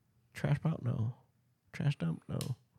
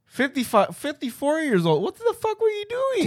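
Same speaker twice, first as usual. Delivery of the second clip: rising squeaky mock voice. Mock doctor, pitch climbing.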